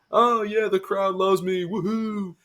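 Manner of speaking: high-pitched mock crowd-pleaser gloating; mock gleeful. Wrestling boss basking in the crowd's love, mock gleeful. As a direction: crow with exaggerated, high delight. Mock Triple H soaking up cheers.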